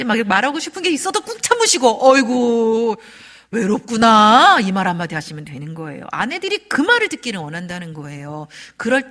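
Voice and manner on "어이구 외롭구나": loud, long drawn-out commiseration; exaggerated, heartfelt "oh dear" empathy; demonstrated sympathy. Shown as a demonstration. Husband comforting lonely wife, with drawn-out sympathy.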